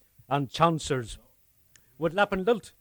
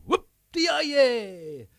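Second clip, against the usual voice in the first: higher, louder yodelling whoop; cowboy whoop; 9 semitones higher, 3 dB louder than usual. Retold or imitated. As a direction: let out a cowboy whoop on the ride. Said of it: "hollering and gulderin'".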